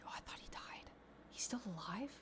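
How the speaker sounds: hushed whisper, no voice. Real, not acted. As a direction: whisper it under your breath. Quiet aside while streaming.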